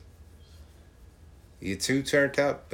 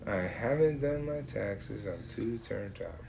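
slow mocking quote; 2 semitones lower, 7 dB quieter, slower than usual. Mocking the lyric again.

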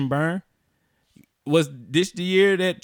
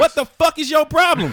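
loud exasperated shout; yelling; 11 semitones higher, 6 dB louder than usual. Real, not acted. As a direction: yell it in mock anger. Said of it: "Stop yelling at me".

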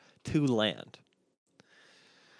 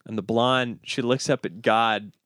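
ordinary talk, nothing marked

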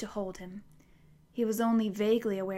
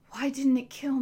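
groaning; 4 semitones higher, 6 dB louder than usual. A narrator's reading. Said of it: "he groaned".

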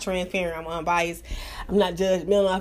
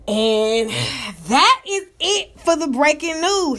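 higher, slow mock anchor sign-off; slow, higher mock news anchor wrap-up; 4 semitones higher, 3 dB louder, slower than usual. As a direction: close the segment like a TV anchor. Wrapping up her news segment.

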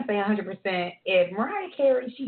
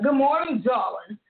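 lightly raised, sing-song "Good morning, darling"; mock diva coworker; 3 semitones higher, 3 dB louder, slower than usual. Host imitating a pretentious coworker's morning greeting. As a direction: greet like a self-styled star. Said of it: "She'd be like".

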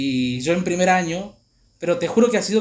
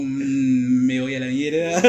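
low, drawn-out groan of doom; sinking dread; 5 semitones lower, much slower than usual. Retold or imitated. Student foreseeing expulsion, low groaning dread. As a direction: hum low, realising you are done for.

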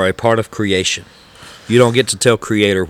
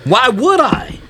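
incredulous high question; 13 semitones higher, slower than usual. Real, not acted. Rhetorical, high pitch.

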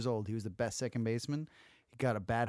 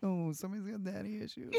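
high, squeaky taunting voice; sing-song high mockery of a friend; mock teasing voice; 13 semitones higher than usual. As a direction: tease him in a silly high voice.

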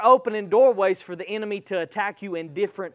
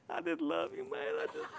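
soft, high, teary mock-drunk voice; maudlin drunk; 9 semitones higher, 16 dB quieter, faster than usual. Preacher acting out a person who gets drunk, mock maudlin. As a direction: imitate a weepy drunk gushing affection. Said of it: "They get drunk".

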